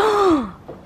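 sharp high gasp of alarm; quick frightened intake of breath; startled gasp. Real, not acted. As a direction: catch your breath in sudden fright. Startled as the scaffold shakes.

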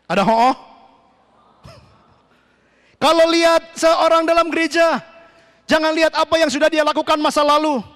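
high, emphatic exhortation with shouted commands; forceful preaching. Real, not acted. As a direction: command the congregation like a fired-up preacher. (khotbah berapi-api, lantang)

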